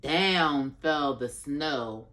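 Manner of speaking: very slow storybook build-up; reading to a toddler. Acted. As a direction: draw it out before the surprise.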